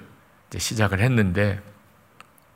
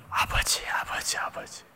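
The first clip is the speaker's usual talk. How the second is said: whispered, growing ever softer; fading tearful whisper; 10 dB quieter than usual. Retold or imitated. Praying alone to God, weeping whisper fading to silence. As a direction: let each call sink nearly to nothing. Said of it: "한참을 울고".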